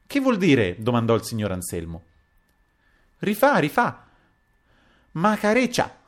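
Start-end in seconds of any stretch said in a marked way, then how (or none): 0.0-0.8 loud, high-pitched demand to know; raised-voice puzzlement; startled question. A narrator's reading. Anselmo questions the sudden cries. 3.2-6.0 put-on very high, excited girlish squeal; high-pitched playful foreign girl; Pepita's voice. A narrator's reading. Narrator voices Pepita calling to spirit.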